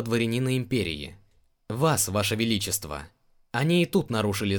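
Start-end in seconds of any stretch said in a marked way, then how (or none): none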